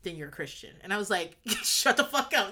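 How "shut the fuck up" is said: mock outrage. Friend mock-swearing at her.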